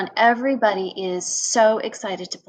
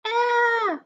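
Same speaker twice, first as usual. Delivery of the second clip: excited; 13 semitones higher than usual. Acted. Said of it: "voices the excited player".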